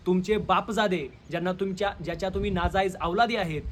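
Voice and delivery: normal talking voice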